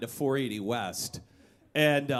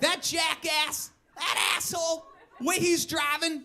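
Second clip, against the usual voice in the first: high, strained mock yell at a passing driver; mock road rage yell; 12 semitones higher than usual. Commuter yelling at a reckless driver, mock rage. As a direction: shriek it like an outraged commuter. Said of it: "you always say".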